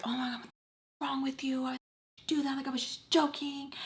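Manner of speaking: high-pitched acted protest; upset retort. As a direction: imitate an upset, high-voiced comeback.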